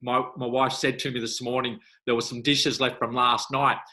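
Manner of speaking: ordinary talk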